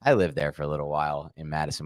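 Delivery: ordinary talk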